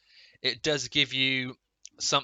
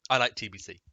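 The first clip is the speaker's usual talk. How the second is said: choking on a drink; faster than usual. Real, not acted. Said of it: "that went down the wrong way".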